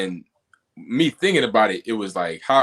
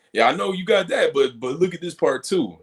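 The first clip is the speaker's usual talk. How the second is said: higher, eager know-it-all comeback; mock rebuttal; 8 semitones higher, 3 dB louder than usual. Mocking his old habit of arguing back. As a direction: imitate yourself always having a comeback.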